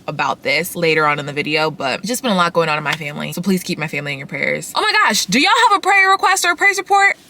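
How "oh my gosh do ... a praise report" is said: high, loud burst of excitement; suddenly remember and gush; excited. Real, not acted. Excited sudden remembering to ask viewers.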